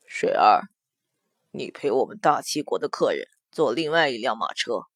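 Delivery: deep, low male voice; commanding prince. A narrator's reading. Prince sends Huo Shui to another carriage, deep and composed. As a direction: quietly give an order, pitched very low.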